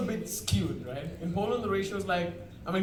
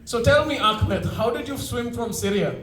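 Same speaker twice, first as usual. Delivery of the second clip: loud, higher, slow mocking shout; loud yell; 4 semitones higher, 7 dB louder, slower than usual. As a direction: yell it across the shop to embarrass him. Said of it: "he yells out loudly".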